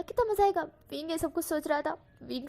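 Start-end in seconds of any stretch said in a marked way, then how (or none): 0.0-0.6 much higher, squealing with delight; मज़ा stretched high and gleeful; giddy glee. A narrator's reading. Repeated gleeful thought.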